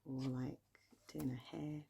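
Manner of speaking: usual speaking voice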